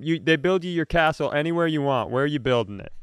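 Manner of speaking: ordinary talk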